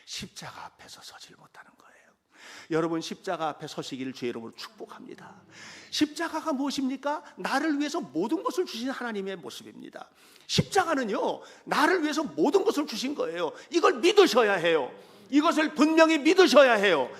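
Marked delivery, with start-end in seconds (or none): 0.0-1.9 soft unvoiced whisper; whispered, intimate sermon line; hushed sermon point. Real, not acted. Sermon drops to hush. 5.9-17.1 loud, high, ringing sermon climax; fervent, loud pulpit preaching; full-force preaching. Real, not acted.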